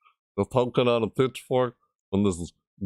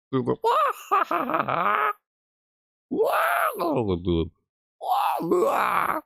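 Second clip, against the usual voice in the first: silly high shrieks; slower than usual. Real, not acted.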